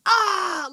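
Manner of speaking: loud, high "Ah!" of sudden headache; exaggerated yelp of pain; mock pained cry. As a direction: imitate a headache with a sharp cry. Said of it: "when he has a headache".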